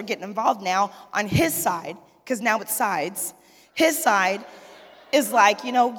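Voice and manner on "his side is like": normal talking voice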